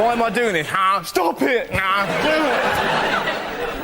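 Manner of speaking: slow, drawn-out teasing; mock inner voice. Driver tooting at the old lady, slow and teasing. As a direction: draw it out, torn and giggling. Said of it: "when you kinda go".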